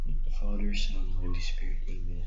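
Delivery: loud fervent prayer before eating; saying grace. Real, not acted. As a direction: pray loudly over the food. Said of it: "I'm gonna pray now".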